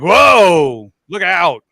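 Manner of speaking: a drawn-out, swooping "whoa" of mock alarm; mock startled reaction. Imagined onlookers reacting to his makeup, mock startled shout. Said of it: "then they'd be like".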